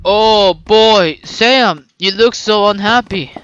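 ordinary delivery

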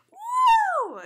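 high falsetto woo. Retold or imitated.

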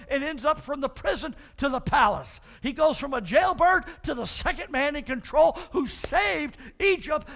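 loud, high preaching; fiery sermon to the congregation; full preaching. Acted. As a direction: preach it out to the whole church.

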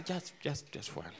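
hesitant, barely audible low reply; reduced volume. Acted. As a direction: answer in an embarrassed hush. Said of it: "reduce the volume".

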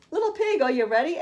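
high calling wolf. Acted. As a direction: call out sweetly as the wolf. Wolf calls at the door.

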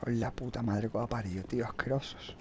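soft muttered swearing; cursing under breath. Real, not acted. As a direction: grumble curses half to yourself. Quiet cursing at enemies. (maldiciendo en voz baja)

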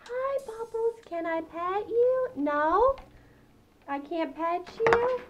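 high, slow coaxing voice; high and gentle, drawn-out words; gentle pet talk. Real, not acted. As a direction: coo questions to a pet bird. Coaxes her pet birds.

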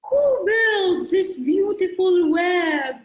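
high, slow, hooting owl voice; owl character. A narrator's reading. Owl asking the spider about the web, slow hooting voice. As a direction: stretch it out like an owl's hoot. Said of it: "Asked the owl".

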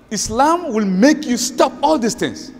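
usual speaking voice

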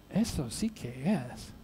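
very quiet, low, slow repeat; hushed, low, bewildered clerk; baffled echo. Acted. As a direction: echo it slowly under your breath, puzzled. American clerk echoes him, puzzled.

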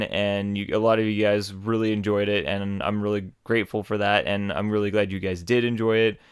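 ordinary delivery

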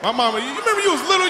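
ordinary delivery

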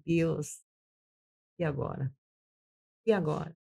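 soft, slow, helpless prayer to God; whisper-soft, lost and pleading; locked in a cell, quietly begging God; hushed plea. Retold or imitated. Desperate prayer alone in cell.

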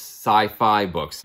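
ordinary delivery

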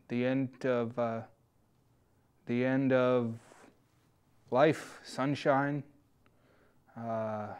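slow, hushed and grave; somber. Acted. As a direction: mourn it with long pauses. Somber reflection, long pauses.

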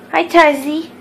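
loud sing-song greeting. Real, not acted. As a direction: coo the dog's name. Greeting the dog.

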